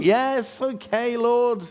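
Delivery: high, loud mock exasperation; exasperated giving in. Preacher answering the Lord's nagging, exasperated.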